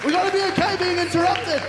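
high, loud proclamation; preaching at full force. Real, not acted. Preacher urging the congregation, full force. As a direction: hammer the point home.

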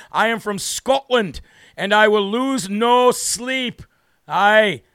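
put-on a light, slow Scottish accent reading a comment; hammed-up Scottish viewer voice. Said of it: "He does horrible accents".

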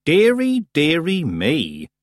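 high first "Dearie", sinking slowly; drawn-out, exaggerated tutting tone; mock concern. A narrator's reading. Granny hushes Tiffany and answers, mock concerned.

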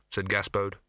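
ordinary delivery

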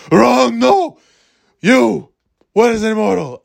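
put-on loud, high old New York teacher; Ken Jacobs impression. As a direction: pounce on a student with a barked challenge. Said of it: "that's what Ken Jacobs sounded like".

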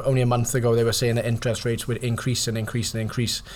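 ordinary delivery

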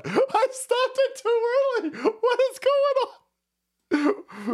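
very high, incredulous outburst; astonished squeal. Real, not acted. Host finding the clip keeps going, astonished squeal. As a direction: squeal it in disbelief.

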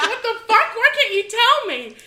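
high, loud mock protest with a laugh; a kid's exasperated retold yell; indignant outburst. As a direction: demand an answer, half-laughing.

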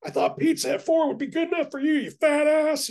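high mocking impression of the wife's snap. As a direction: exaggerate her snapping into a shrill cartoon. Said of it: "you were like".

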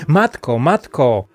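loud excited call for mother. A narrator's reading. Niels calls to his mother from the doorstep, calling out. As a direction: raise the voice, falling on each call. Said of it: "wołał już u progu".

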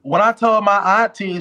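ordinary talk

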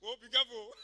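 words broken by giggles; contagious laughter breaking up the threat; helplessly laughing. Acted. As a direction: try to protest while cracking up. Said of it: "he's now laughing".

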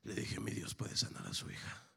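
soft, low preacher storytelling; hushed testimony. Acted. Preacher telling a father his God can heal his daughter, hushed testimony. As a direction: draw the congregation in quietly with pauses.

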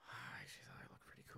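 whispered, sheepish. Retold or imitated. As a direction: breathe it out like an embarrassed kid. Sheepish retold reply, whispered.

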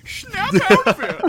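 very high, loud, slow diva take-off; exaggerated celebrity voice at full volume. Shown as a demonstration. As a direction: wail it out, stretched and hammed-up. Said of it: "I'll tell you what she sounds like".

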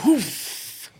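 high puff of knocked-out breath; imitated winded whoof. Man landed on gets winded, imitated whoof. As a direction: mimic the wind being knocked out. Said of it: "You just see him".

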